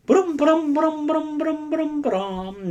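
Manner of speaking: high playful brum brum sounds; silly vroom noises. Real, not acted. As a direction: hum brum brum like a toy engine.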